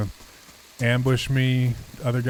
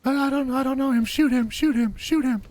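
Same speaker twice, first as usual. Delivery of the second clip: high falsetto woman's voice, fast and urgent; a man in drag begging to shoot him; panicked fake lady voice; 12 semitones higher than usual. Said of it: "Disguise your voice like a lady?".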